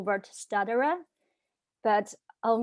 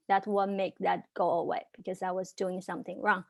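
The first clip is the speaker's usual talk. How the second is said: ordinary delivery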